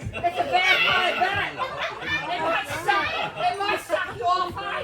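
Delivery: scared horror game streamer. Acted. Mock panic at imaginary creature.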